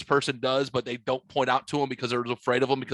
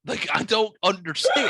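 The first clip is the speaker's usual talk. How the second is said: louder, higher mock exasperation; exasperated outburst; 6 semitones higher, 4 dB louder than usual. Mimicking the sister's baffled outburst. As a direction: blurt it out, baffled and loud. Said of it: "and she goes".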